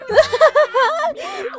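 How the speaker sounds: high, loud cackling giggle; giddy laugh. Acted. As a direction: burst out in silly high laughter. Laughing at Brain's plan.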